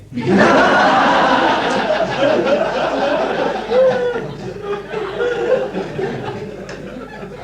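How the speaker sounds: long high laugh at his own punchline. Real, not acted. Delivering the Sydney taxi punchline, long delighted laugh.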